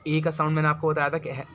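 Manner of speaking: ordinary delivery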